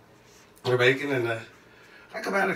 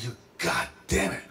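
whispered curse; 4 semitones lower, 7 dB quieter than usual. Retold or imitated. Cursing under breath.

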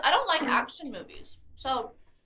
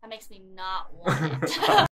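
laughing; 3 semitones lower, 8 dB louder, slower than usual. Real, not acted. Joking while laughing.

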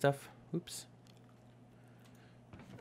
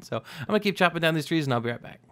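ordinary talk, nothing marked

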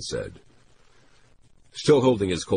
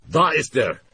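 high, louder German call; 11 semitones higher, 5 dB louder than usual. A narrator's reading. SD gunman spots Baines, in German. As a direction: point him out in German. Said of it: "one said".

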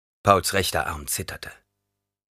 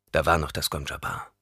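usual speaking voice

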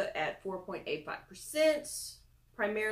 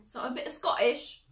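put-on playful, louder stab at a Scottish accent; mock Scottish accent; 5 semitones higher, 5 dB louder than usual. Vlogger jokes about Scottish ancestry in DNA results, mock Scottish accent. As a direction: try on a Scottish accent, pitched up.

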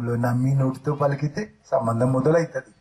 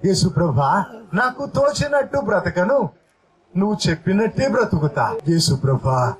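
earnest spoken prayer, pitched up; prayer said aloud; 4 semitones higher than usual. Shown as a demonstration. Preacher leads the congregation's vow to Jesus, earnest prayer. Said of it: "అని బయటికి చెప్పు పలికి".

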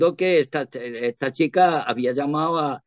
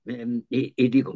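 usual speaking voice

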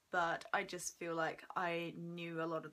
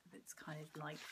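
whisper; 4 semitones lower, 11 dB quieter than usual. Real, not acted. Casual aside about a book.

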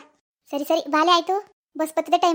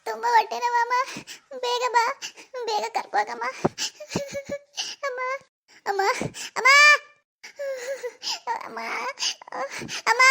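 1 crying in pain; 5 semitones higher than usual. Acted. Daughter in labour pain crying.

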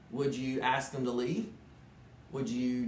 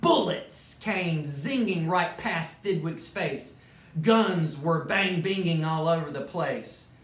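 lively storybook narration; animated read-aloud; reading to children; 5 semitones higher, 5 dB louder, slower than usual. A narrator's reading. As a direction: tell it like a bedtime story to kids. Reads Dr. Seuss story aloud.

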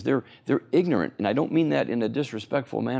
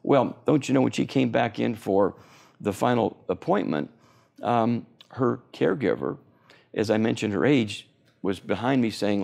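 ordinary talk

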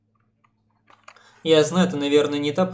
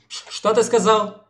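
flustered surprise; 7 semitones higher, 5 dB louder, slower than usual. Acted. [name] reacts to teasing.

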